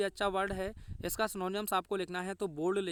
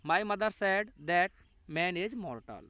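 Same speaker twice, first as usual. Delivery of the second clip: slow dictation; much slower than usual. Shown as a demonstration. Teacher dictates the indirect speech answer, slow and clear. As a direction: answer read slowly, "that" set in clearly.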